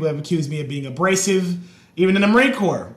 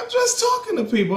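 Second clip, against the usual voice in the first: retold innocent protest.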